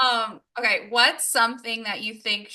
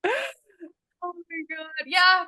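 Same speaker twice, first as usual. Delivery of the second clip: crying laughing; 5 semitones higher, 4 dB louder, much slower than usual. Real, not acted. Laughing hard at friend.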